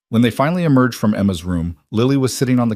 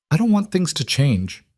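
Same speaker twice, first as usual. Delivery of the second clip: put-on higher, small little-girl voice; tiny voice; 8 semitones higher than usual. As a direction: shrink into a small child's voice. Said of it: "Emma said in a tiny voice".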